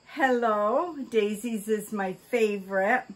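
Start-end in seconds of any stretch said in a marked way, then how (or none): none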